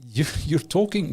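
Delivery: ordinary talk, nothing marked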